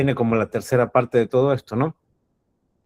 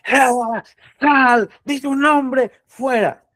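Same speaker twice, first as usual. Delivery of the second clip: high shrill commanding yells at the demon; movie exorcist shouting; 12 semitones higher, 3 dB louder, much slower than usual. Retold or imitated. Priest yelling at a demon like in the movies, high and loud. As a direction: bellow like a film exorcist. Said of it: "pensábamos que eran como las películas".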